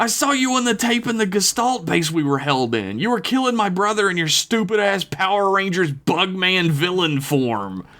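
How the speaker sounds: high-pitched enraged rant; furious accusation. A narrator's reading. Jason accusing Rio of killing his brother, high furious rant.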